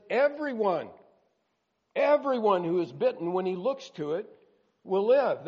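loud, rising scripture proclamation; preaching at full force. Acted. Preacher proclaiming God's promise to the bitten, loud. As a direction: proclaim the verse to the crowd.

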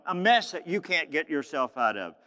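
ordinary delivery

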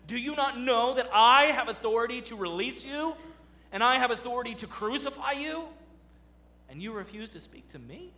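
preacher voicing Pilate looming over Jesus, mildly arrogant. Mock arrogant demand, fading at the end. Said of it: "you see the arrogance he has".

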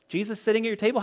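ordinary talk, nothing marked

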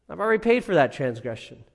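quick restatement. Retold or imitated. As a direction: rush the reassurance through. Christ pleading before the Father.

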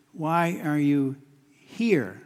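slow question, pause before a drawn-out "here"; stress on HERE. Acted. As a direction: lean on the last word, "here", after a short hold. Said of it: "the emphasis on that word "here".

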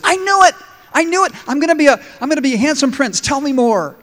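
high, loud, thrilled yelps; excited frog. Acted. Frog hearing of a beautiful woman, squealing with excitement. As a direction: frog bursting with glee at the news.

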